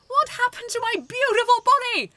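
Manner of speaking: loud, very high indignant cry; shouting. Acted. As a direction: shout in horror at the new body. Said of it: "he shouted".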